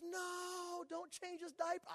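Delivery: lightly voiced wife protesting from bed; a touch of an alarmed new mother; imitating his wife. Wife stops husband changing diaper.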